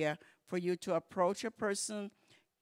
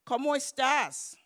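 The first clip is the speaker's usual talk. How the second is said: warning example; 6 semitones higher, 6 dB louder than usual. Shown as a demonstration. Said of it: "watch that".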